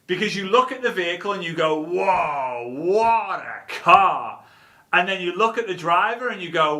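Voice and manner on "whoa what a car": slow, drawn-out wonder; awed admiration. Acted. Onlooker looking at the vehicle, slow awe. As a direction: stretch each word, impressed.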